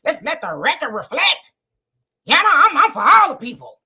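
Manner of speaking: mocking, swinging sassy delivery; sassy, haughty mimicry. Host imitating a politician scolding his comment section, sassy. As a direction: ham up an indignant, sassy politician. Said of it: "that sassiness and that thing".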